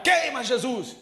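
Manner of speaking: loud, high hammed-up shout; a preacher mocking a street shout; mock yell. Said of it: "em vez de gritar".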